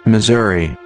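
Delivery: ordinary talk, nothing marked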